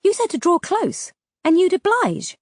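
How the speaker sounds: high, indignant protest. Acted.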